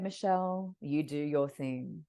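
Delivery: low, soft, slow and soothing voice; serene divine woman. Retold or imitated. Client putting her arms out to the medium, serene and slow. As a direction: draw it out gently, like an angelic being. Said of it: "she talks like this".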